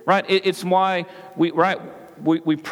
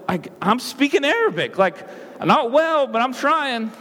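frustrated insisting; 8 semitones higher than usual. Retold or imitated. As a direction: plead in exasperation, voice climbing. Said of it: "So I'm getting frustrated".

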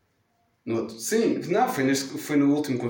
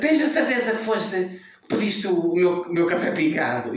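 higher, slow, insistent; impatient mother; 5 semitones higher than usual. Retold or imitated. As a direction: nag the waiter, higher and drawn out. Imita a mãe impaciente. (mãe impaciente, mais aguda e lenta)

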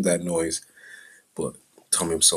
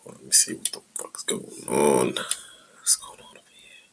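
whisper; 5 semitones lower, 3 dB quieter than usual. Real, not acted. Checking something off-mic, whispering.

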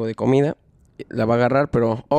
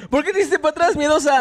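high, laughing taunt; mock him through laughter, much higher; teasing. Real, not acted. Teasing friend in face-off.

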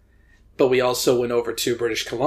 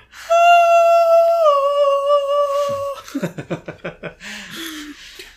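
loud burst of laughter; 7 dB louder than usual. Real, not acted. Reacting to fist-raising question.

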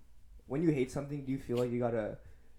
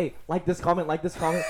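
higher, louder hyped-up commenter voice; mock fanboy commenter; 5 semitones higher, 6 dB louder than usual. Imitating a [name] fan begging for likes, hyped-up and loud. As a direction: beg for likes like an overeager fan. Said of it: "He'll be like".

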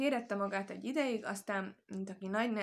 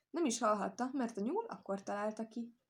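plain reading voice

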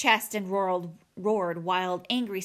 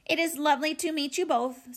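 higher, polite robot voice; be formally pleasant, pitched up; courteous robot pleasantry; friendly robot; 6 semitones higher than usual. A narrator's reading. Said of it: "the robot's friendliest voice".

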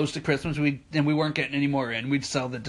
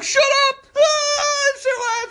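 very high, loud mock-sobbing yell; hammed-up wailing shout; 5 dB louder, slower than usual. Imitating manager defending his gift to her, wailing. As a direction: bawl it as a mock outburst. Acts manager Joe's emotional outburst.

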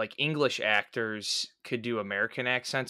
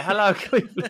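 higher, half-laughing rock-show greeting; mock stage call; 8 semitones higher, 4 dB louder than usual. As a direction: call out playfully to an imagined crowd. Imitates lost band calling to crowd.

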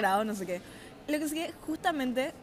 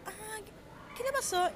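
imitated doctor; 10 semitones higher, 6 dB quieter than usual.

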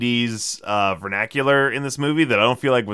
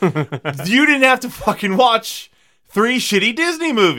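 ranting; 11 semitones higher, 4 dB louder than usual. Real, not acted. Ranting about the filmmakers.

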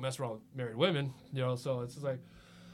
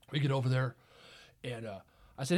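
ordinary delivery, nothing marked

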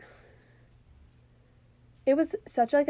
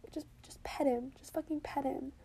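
urgent whisper; 10 dB quieter than usual. Retold or imitated. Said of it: "I'm like".

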